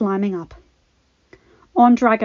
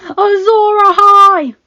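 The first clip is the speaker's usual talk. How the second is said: much higher, louder, slow shout; 12 semitones higher, 12 dB louder, slower than usual. Acted. Bran raising his glowing sword, shouting. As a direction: shout it to the sky. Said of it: "shouted Bran".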